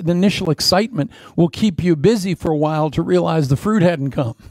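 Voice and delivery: normal talking voice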